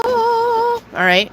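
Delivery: high mock stage announcer; mock stage call. Reader playing a performer hyping the crowd, high.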